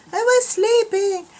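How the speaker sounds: high, slow whiny dog voice; voicing the woken dog. Dog Molly complains to her owner, high whine. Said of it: "Look, she's like".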